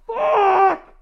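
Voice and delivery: high, loud furious shout; angry outburst. Acted. Host cursing the election result, furious loud shout. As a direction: explode with the curse.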